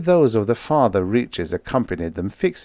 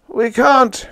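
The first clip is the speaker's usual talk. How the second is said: high, loud wail of despair; despairing moan; 5 dB louder than usual. A narrator's reading. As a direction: moan it helplessly, high-pitched. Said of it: "Fitzhugh moaned".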